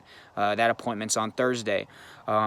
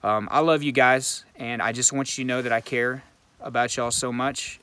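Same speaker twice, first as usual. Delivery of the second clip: usual speaking voice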